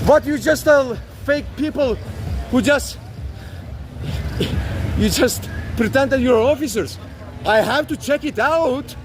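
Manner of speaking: usual speaking voice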